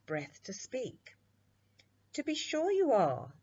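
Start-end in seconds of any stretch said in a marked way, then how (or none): 2.1-3.3 high, louder amused reply; smiling witch. A narrator's reading. The witch smiles at the runaway princess, pitched up and louder. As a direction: smile the words, bright and lifted. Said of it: "smiled the Weird Witch".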